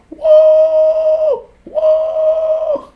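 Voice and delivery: high, very loud drawn-out holler; imitated party whoop. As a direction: whoop like a rowdy party guest, full volume. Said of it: "He's just screaming".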